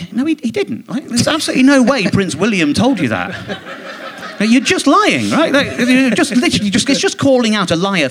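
real exasperated, half-laughing outrage; exasperated disbelief. Real, not acted. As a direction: scoff at an obvious lie. Exasperated rebuttal of a lie.

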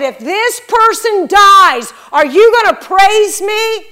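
loud, high, confronting question; forceful challenge. Retold or imitated. God stopping the reader right there, retold as a loud challenge.

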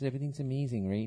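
high, quick, bubbly LA accent; chirpy LA accent, everything upbeat and fast. Shown as a demonstration. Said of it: "the one that it's up here".

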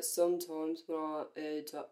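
low, soft British pop star voice; half-hearted second go, pitched low and quiet. Shown as a demonstration. Friend retrying her singer impression before giving up, low soft voice. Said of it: "get the [name] impression".

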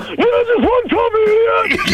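very high, slow, yelled order; hammed-up coffee order. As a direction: squawk the order like a giddy goof. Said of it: "it's like".

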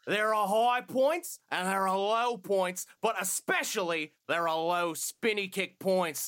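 put-on hammy Aussie villain intro in a thick Australian accent, slowing down at the end; Kano Australian accent. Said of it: "nine out of ten Australian accent".

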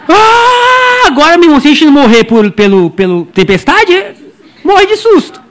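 a touch of laughing mock panic; mock fright. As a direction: ham up a sudden scare. Imagining terror at sea.

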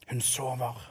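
soft hushed words at a sickbed; whispered. A narrator's reading. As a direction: whisper so as not to wake her. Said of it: "hvisket Anne". (hvisket stille ved sengen)

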